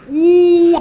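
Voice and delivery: high, loud comic bawl; hammed-up wail of a big man crying; mock crying wail. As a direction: imitate a burly man bursting into sobs. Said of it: "badan sadu teriak macam mana".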